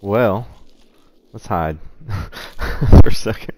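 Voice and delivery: loud, slow, laughing through it; nervous laugh. Real, not acted. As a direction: laugh it off while retreating.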